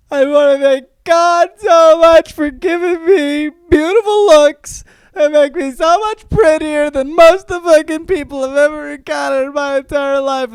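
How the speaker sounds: hammed-up vain actor shouting his thanks; over-the-top vain winner; mock gushing shout.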